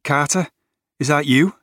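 a light Liverpool voice reaching across the dark; Scouse accent. A narrator's reading. Tom calls to his best mate Carter, Scouse accent. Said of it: "Tom's Scouse accent".

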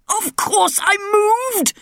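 high indignant roar from the king. A narrator's reading. King roaring at his daughter, high indignant roar. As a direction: bellow it like an outraged king. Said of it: "roared the king".

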